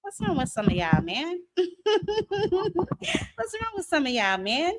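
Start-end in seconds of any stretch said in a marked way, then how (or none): 1.5-4.7 slow, high, laughing through the words; repeating the question while cracking up. Real, not acted.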